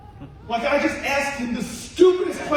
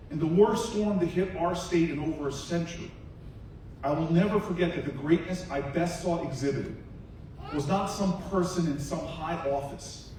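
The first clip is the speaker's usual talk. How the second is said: hushed solemn speech; 10 semitones lower, 7 dB quieter than usual. Acted. Senator honoring the old man with the light to the crowd, hushed and solemn. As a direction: bring the room in close and hush it.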